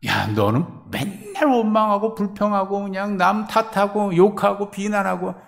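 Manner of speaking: high, loud scolding; rebuke. Acted. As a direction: scold the servant who always blames others. God rebukes a grumbler. (높고 크게 꾸짖는 목소리)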